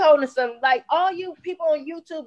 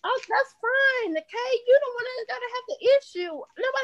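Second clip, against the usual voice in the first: mocking sing-song; 8 semitones higher, 3 dB quieter than usual. Real, not acted. Taunting the angry co-host.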